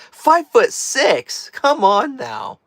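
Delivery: mock disbelief. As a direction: drag it out in playful outrage. Scoffing at low height guess.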